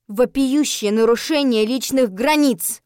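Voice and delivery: loud, drawn-out indignant outburst; blurted accusation. A narrator's reading. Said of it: "выпалила я".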